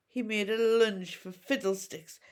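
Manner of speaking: ordinary delivery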